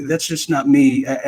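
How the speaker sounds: ordinary delivery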